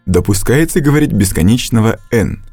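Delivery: normal talking voice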